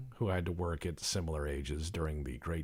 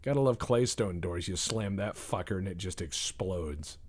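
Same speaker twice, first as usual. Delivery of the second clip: normal talking voice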